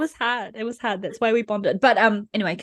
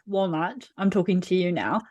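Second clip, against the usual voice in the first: ordinary delivery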